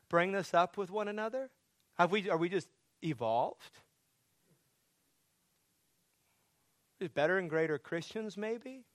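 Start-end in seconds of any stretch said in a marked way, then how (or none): 1.9-8.8 teasing, tongue-in-cheek question; mock sarcasm. Preacher teasing the congregation about being evolved, mock sarcastic. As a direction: needle them with a joking what-if. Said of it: "I'm, I'm joking".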